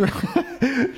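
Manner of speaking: high-pitched chuckle; amused laugh. Real, not acted. Host joking about thanking himself, amused laugh. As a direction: laugh at your own joke.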